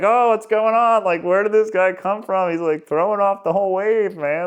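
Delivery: high, loud mock-panicked chatter; frantic. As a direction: act out beings all freaking out. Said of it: "they're all freaking out and they're kind of frantic".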